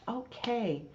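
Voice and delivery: ordinary delivery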